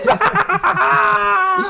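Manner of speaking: high bursting laugh; sudden high-pitched burst of laughter; big laugh. Real, not acted. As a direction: crack up at what you just saw.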